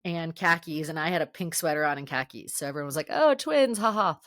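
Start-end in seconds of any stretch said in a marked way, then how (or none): 3.1-4.2 higher mock-amused group teasing. Friends ribbing two roommates in matching sweaters, mock-amused. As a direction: imitate friends joking, with a token ha ha. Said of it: "we all started laughing".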